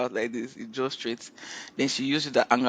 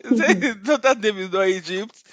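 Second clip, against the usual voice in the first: laughing; 7 semitones higher, 5 dB louder than usual. Real, not acted. Retelling through her own laughter.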